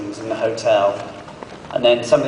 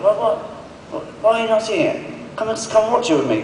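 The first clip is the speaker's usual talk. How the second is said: high, insistent impersonation of Martin urging; Martin pleading; 11 semitones higher, faster than usual. Retold or imitated. Imitating a co-star begging him to come watch, high and pushy. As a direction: squeak it out like a friend who can't believe you'd skip it. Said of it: "He was like".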